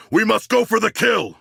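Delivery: rally the squad for the kill; battle cry. Acted. King Shark urging the squad after Superman falls, battle shout.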